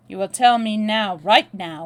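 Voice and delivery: loud, hard order; commanding, insistent and loud; forceful demand. A narrator's reading. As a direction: demand an answer at full volume. Acted demand at gunpoint.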